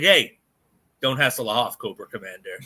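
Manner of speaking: lightly acted movie-hero one-liner, dropping low; imagined action-hero line. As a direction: deliver a cool catchphrase, trailing down low. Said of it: "his line is when Cobra comes in, he's like".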